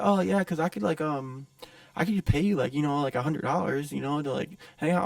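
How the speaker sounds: high, halting awkward offer; skittish stranger. Retold or imitated. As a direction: imitate a nervous man tiptoeing into a proposition. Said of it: "like, kinda, like, skittish".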